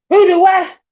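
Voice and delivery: high, loud chopping yell; whooped battle cry as the blow lands; mock attack cry. Swinging the hoe at the snake, mock attack cry.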